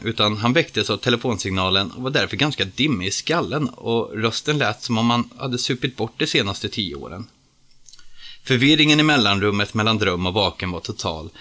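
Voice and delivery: ordinary delivery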